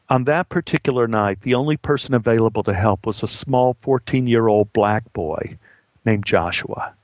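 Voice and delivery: usual speaking voice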